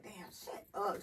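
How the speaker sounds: slow hushed scold to herself; muttered under the breath, slow; whispered self-scold. Retold or imitated. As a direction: whisper it in disgust. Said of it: "I be like".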